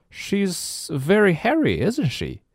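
high-voiced babysitter Nelly; Nelly's voice. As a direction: lift the pitch high for Nelly. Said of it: "said Nelly".

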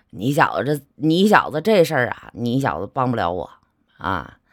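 a touch of a resigned uncle chuckling at his nephew; bitter laughing uncle. Retold or imitated. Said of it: "摇着头苦笑着就说".

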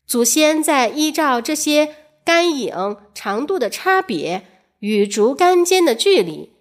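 ordinary delivery

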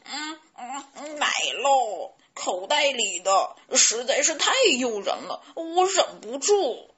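loud gobbling chick confessing; mouth full. A narrator's reading. Chick confessing to eating the cheese, mouth full. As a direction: talk round a mouthful of cheese. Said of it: "贝利奥嘴里塞得满满的".

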